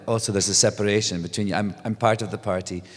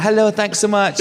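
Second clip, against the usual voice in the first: high, louder cheery thanks; bright passing thanks; 11 semitones higher, 6 dB louder than usual. Retold or imitated. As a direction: thank fans while waving on.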